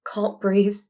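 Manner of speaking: ordinary delivery, nothing marked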